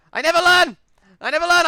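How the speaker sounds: loud high laughing self-scolding yell; self-mocking yell. Real, not acted. Gamer mocking his own mistake, loud yell. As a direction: cry out at your own blunder. Barged into orcs again.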